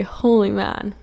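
normal talking voice

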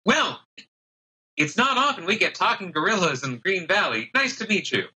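high, chipper halfling. Acted. Halfling welcoming the gorilla, high and chipper.

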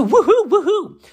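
high, loud woohoo; giddy, high-pitched cheering; celebratory cheer. Real, not acted. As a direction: whoop it up for good news. Cheering the coming of spring.

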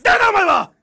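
high, loud exasperated yell; shouted comeback. Acted. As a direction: yell in comic disbelief. Customer yells at absurdity.

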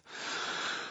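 shuddering breath out; shaky sigh. A narrator's reading. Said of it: "let out a shuddering breath".

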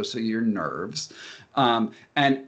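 ordinary talk, nothing marked

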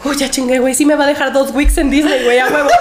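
triumphant. Retold or imitated. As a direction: celebrate the win. Celebra pasar, riendo. (celebrando emocionada)

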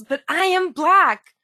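imitating an indignant yell, drawn out; retold yell. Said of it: "and she yells".

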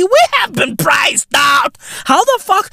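high, incredulous mock outrage; freaking out. Comedian imitates priced-out normies, freaking out. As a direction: act out a panicked complaint.

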